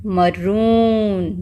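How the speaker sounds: मरून with a very drawn-out oo; long oo. Shown as a demonstration. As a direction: slowly stretch the oo in मरून. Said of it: "sound ज़्यादा long चल रही है".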